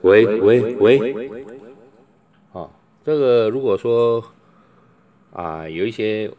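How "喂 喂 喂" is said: 对着麦克风大声喊喂; 连喊几声喂试音; 试麦喊喂. Shown as a demonstration. 试麦克风喊喂. (大声喂喂试麦)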